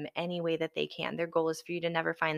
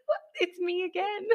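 surprised; 13 semitones higher than usual. Retold or imitated. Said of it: "I was like".

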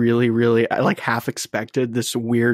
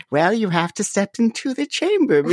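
put-on high, odd old-lady voice; lightly voiced old grandmother; 9 semitones higher than usual. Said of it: "Your grandmother voice".

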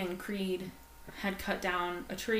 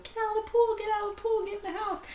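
panicked scream; faster than usual. Retold or imitated. Mother screaming at her kids to get out of the pool, high panicked yell. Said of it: "and I was screaming".

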